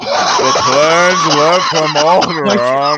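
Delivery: slow, booming shouted accusation; hammed-up villain bellow, drawn out; Darth Vader. Villain interrogating captive over the plans, slow shouted impression. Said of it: "like Darth Vader asking for the plans".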